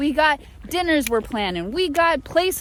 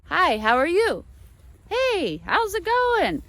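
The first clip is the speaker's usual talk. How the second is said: chipper greeting; 6 semitones higher than usual. Retold or imitated. Mimics American greeting.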